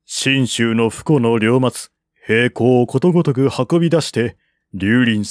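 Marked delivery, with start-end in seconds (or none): none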